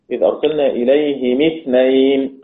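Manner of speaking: إليهم اثنين as "ilayhimi-thnayn"; double kasra reading. Shown as a demonstration. Teacher recites Abu Amr's reading, إليهم as "ilayhimi" with double kasra. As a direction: recite إليهم with i after both h and m, joined on. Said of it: "قراءة أبي عمرو".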